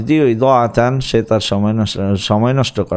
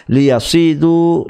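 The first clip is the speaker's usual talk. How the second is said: লিয়াসিদু spoken slowly, each syllable clear and a bit higher; slow careful demo; 4 semitones higher than usual. Shown as a demonstration. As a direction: model লিয়াসিদু slowly for students to copy. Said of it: "শিক্ষার্থীরা উচ্চারণটি স-, লক্ষ্য করবেন".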